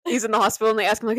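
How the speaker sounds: usual speaking voice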